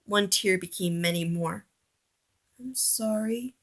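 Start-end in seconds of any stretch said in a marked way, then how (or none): 2.6-3.5 much softer broken voice; crying apology; tearful apology. A narrator's reading. Oliver apologizes in tears, very soft. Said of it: "a tear trickled down".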